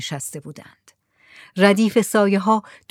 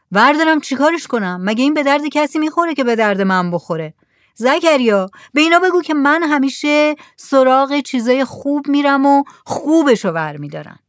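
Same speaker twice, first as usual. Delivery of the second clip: lightly voiced mullah, higher, boastful; proud mullah; 6 semitones higher, 4 dB louder than usual. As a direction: brush off their junk with pride. (ملای مغرور، کمی بلندتر)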